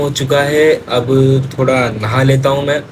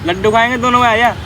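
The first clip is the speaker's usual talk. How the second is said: high, raised voice calling a friend over; 9 semitones higher than usual. Real, not acted. Greeting his arriving friend with a high call-out. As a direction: hail your buddy from across the way.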